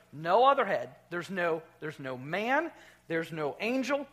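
usual speaking voice

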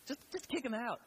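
high-pitched exasperated plea; fed up. Acted. Host wanting Uncle Jimmy gone, fed up. Voices wish to eject uncle.

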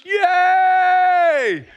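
long, high, very loud yay; wildly celebrating dad's scream; screaming cheer. Retold or imitated. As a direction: hold the cheer out at full voice. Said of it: "the way he was screaming for his boy".

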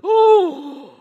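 high, loud horrified oh; shocked oh. Retold or imitated. Pastor's wife hearing the prayer, loud shocked oh. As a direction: cry out in shock. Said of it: "She's like".

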